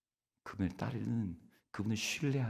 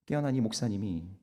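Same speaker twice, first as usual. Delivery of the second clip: normal talking voice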